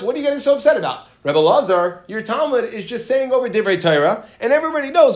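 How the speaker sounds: teacher acting out a sage questioning his colleague's upset; incredulous challenge. Ben Idi challenges Yochanan's anger.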